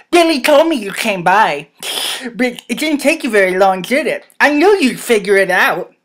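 animated, forceful dialogue voice; Jacob, character voice. Acted.

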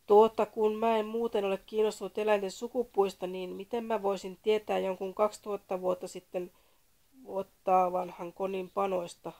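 loud, exasperated, slowing at the end; exasperated reply to the caller, loud; fed up. A narrator's reading. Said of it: "Antti alkoi kyllästyä".

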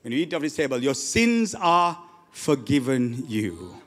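low, slow, drawn-out declaration; grave, measured and deep; solemn pronouncement. Acted. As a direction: pronounce absolution slowly over the congregation. Solemn pronouncement at communion table.

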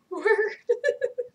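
rising high laugh; amused giggle. Real, not acted. Laughs at his message.

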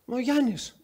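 a hint of an eager man introducing himself; cheerful patient. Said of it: "Λέει".

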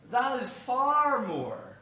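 loud, high, drawn-out protest; hammed-up slow, loud objection; mock outrage. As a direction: balk at the price, stretching "far". Husband balking at the highest price.

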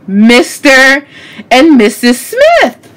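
loud, slow, drawn-out reveal; belted out with pauses between words; dramatic reveal. Real, not acted. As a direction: announce the title like a big discovery. Dramatic reveal, louder take.

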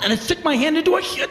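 ordinary delivery

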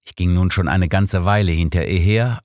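plain reading voice

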